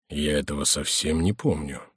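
low, soft, inward voice; murmured puzzlement. A narrator's reading. Prior failing to recall his order, in a hushed low voice. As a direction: drop pitch and volume, as if to himself. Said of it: "прошептал Горанфло".

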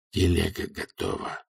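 soft hissed aside; hissing whisper. A narrator's reading. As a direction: hiss it low so nobody hears. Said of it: "и Золт прошипел ему". (шипящий шёпот)

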